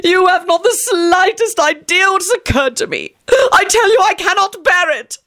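very high voice, half sobbing; melodramatic wail. A narrator's reading. Friend lamenting to the couple, high sobbing wail. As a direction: sob it out like a tragic hero. Tony's melodramatic despair continues.

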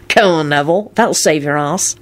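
ordinary delivery, nothing marked

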